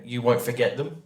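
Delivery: ordinary talk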